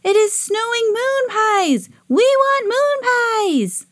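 very high, drawn-out alien chant; Marsmen calling out. A narrator's reading. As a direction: demand it like squealing little Martians. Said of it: "they called out together".

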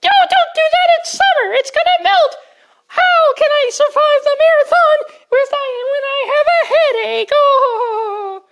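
put-on very high, loud, fretting old lady; panicky granny. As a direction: fret like a frantic grandma.

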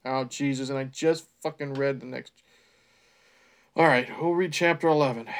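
plain reading voice

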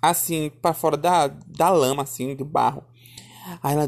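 usual speaking voice

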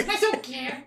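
high puppet voice; Kleber. Acted. Puppet begging to show capoeira, high puppet voice. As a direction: insist as a high-pitched dummy. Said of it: "acha uma voz que pareça com o boneco".

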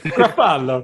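Gruffalo with a rolled, growly G-r and drawn-out vowels, laughing; Gruffalo pronunciation. Shown as a demonstration. Reading the Gruffalo to kids, a laughing growly Gruffalo. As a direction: growl out Gruffalo like a storybook monster. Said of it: "I pronounce Gruffalo as if he's".

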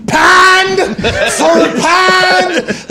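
hammed-up, booming "pand for pand"; Pittsburgh accent. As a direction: shout it high and drawn out in Pittsburgh vowels. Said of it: "That's a Pittsburgh accent".

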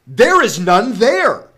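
loud, drawn-out abrupt denial. A narrator's reading. Brother Lustig denying he found the heart, abrupt. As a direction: blurt it out loudly, stretching the words. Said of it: "he said abruptly".

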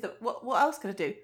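ordinary delivery

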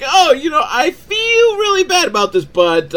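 very high, loud fake-apologetic wail; hammed-up regret, pitch way up; mock remorse. Mom to the baby she is dumping, mock remorse.

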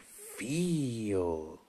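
a hint of the calm master instructing; gentle Bruce Lee imitation; imitating Bruce Lee. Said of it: "how he delivers that line is fantastic".

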